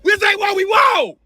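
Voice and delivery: angry crowd. Retold or imitated.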